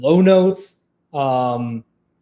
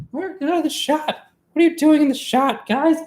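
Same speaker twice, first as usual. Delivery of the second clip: high-pitched scolding off to the side; exasperated calling out; 11 semitones higher than usual. Real, not acted. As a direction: shoo them away in a high voice. Calling someone out of shot.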